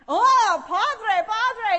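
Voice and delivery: loud, high awed outcry; hammed-up delighted reverence; imitated excited husband. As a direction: exclaim as the overjoyed Italian husband. Husband thrilled to meet a priest.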